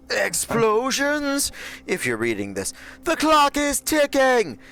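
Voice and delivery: Mr. Torque voice. Acted. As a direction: bellow it like a hammy explosions fanatic. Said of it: "I don't have a good Torque in me".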